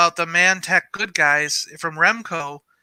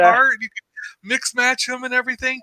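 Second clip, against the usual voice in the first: excited brother; 6 semitones higher than usual. Retold or imitated. Brother seeing the old toy photo, excited high shout. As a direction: gush like a kid who just remembered.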